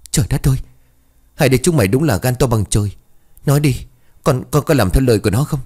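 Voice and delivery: horrified and rushing; aghast. Acted. Mother learns the children dug the grave, panicked fast scolding.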